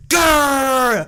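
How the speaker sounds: loud playful growl; mock growl. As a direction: growl at him like a beast. Teacher growls at pupil.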